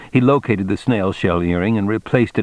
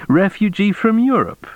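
high-pitched official accusation; accusing cop; 13 semitones higher than usual. A narrator's reading. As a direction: press the charge in a raised pitch. Cop reads out accusation from file.

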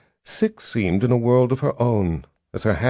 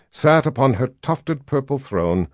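ordinary delivery, nothing marked

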